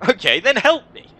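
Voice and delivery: high, loud exasperated plea; exasperated shout. Acted. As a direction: demand help, voice jumping up. Character exasperatedly demands help.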